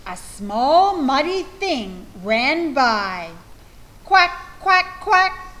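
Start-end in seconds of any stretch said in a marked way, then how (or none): 4.0-5.5 high, loud duck quacks; quacking duck. Acted. Duck sounds in picture book.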